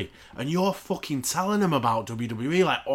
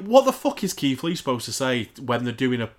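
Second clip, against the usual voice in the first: ordinary talk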